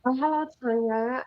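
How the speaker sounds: ordinary talk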